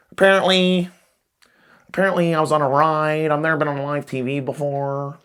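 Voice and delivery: mock the breathless little boy on TV; imitating the Apparently kid. Comedian mimics the viral kid to his audience, excited kid voice.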